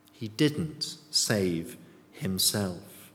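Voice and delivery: normal talking voice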